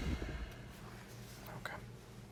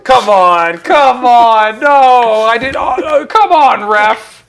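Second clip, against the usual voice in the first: loud high desperate pleading; pleading with the referee; 8 semitones higher, 12 dB louder than usual. Real, not acted. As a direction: beg loudly, voice jumping high. Pleading after being drained.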